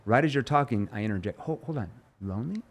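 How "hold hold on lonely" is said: puzzled interruption, lonely quietly rising; confused tone. Shown as a demonstration. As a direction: stop them gently, confused. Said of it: "And I have the confused tone".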